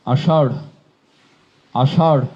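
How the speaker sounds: আষাঢ় slowly split, ending in a plain ড় with no breath. Shown as a demonstration. Teacher showing the exception word. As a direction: drop the h at the end of আষাঢ়. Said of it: "হ হসন্ত হয়ে হবে".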